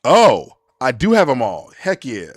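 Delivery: loud, delighted outburst; excited. Real, not acted. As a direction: burst out with real excitement. Excited reply to chat.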